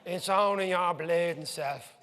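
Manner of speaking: slow, drawn-out cabbie voice, lightly put on; imitating an amazed Dublin cabbie, slowly; Dublin taxi driver. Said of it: "and he goes".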